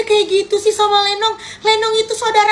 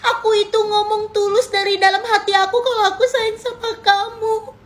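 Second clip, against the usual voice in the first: ordinary talk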